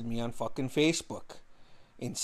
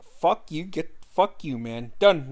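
retold defiance, raised pitch; angry kiss-off; 3 semitones higher, 4 dB louder, slower than usual. Telling off ex who accused him, angry kiss-off. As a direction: punch out the insult, loud last word.

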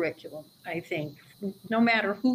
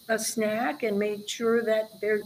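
normal talking voice

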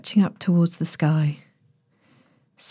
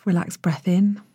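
usual speaking voice

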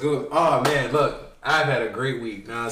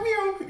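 high squeaky kitten meow; lion cub meow. Retold or imitated. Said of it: "the baby roar going on".

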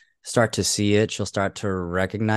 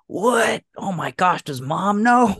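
high-pitched mock shock; mind-blown; 10 semitones higher, 4 dB louder than usual. Co-host imitating the husband hearing about tequila, mind-blown. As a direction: imitate a scandalized brother, pitched way up. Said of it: "he was mind blown".

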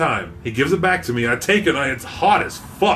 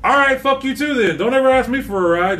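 higher-pitched, mock-furious send-off; retold angry yell; 8 semitones higher than usual. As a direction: hurl it after him, fed up. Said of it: "just mad as fuck".

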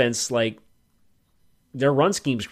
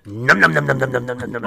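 loud chomping nom noises; snapping teeth; 6 dB louder than usual. Pretend. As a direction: chomp chomp like eating a bar. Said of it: "I'm gonna do this every time".

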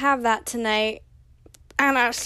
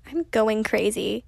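ordinary talk, nothing marked

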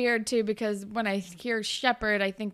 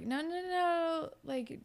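soft, higher retold pleading; hushed protest; 4 semitones higher, 6 dB quieter than usual. Jane tells Spinner not to go after Derek, hushed protest. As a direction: gently imitate her talking him down, very soft.

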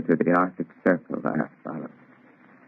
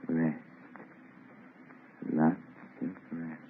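fading murmur; 12 dB quieter than usual. Acted. Dying man echoes captain.

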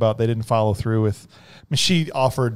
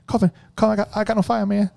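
panicked quote; 9 semitones higher, faster than usual. Retold or imitated. Quoting a panicked movie line.

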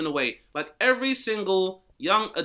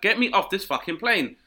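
mock panicked passenger; 7 semitones higher, 5 dB louder than usual.

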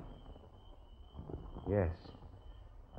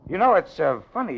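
forced nervous cheer; 9 semitones higher, 8 dB louder than usual. Acted.

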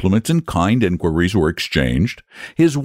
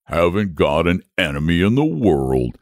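plain reading voice

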